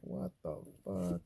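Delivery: slow, drawn-out what the fuck; stunned disbelief. Retold or imitated. Barista reacting to the taco question, slow and stunned. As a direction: let it hang in disbelief.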